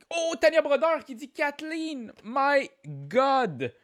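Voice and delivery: very high announcer's burst; excited amazement. Real, not acted. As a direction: call out the winner high, in amazement. Host announces a correct answer.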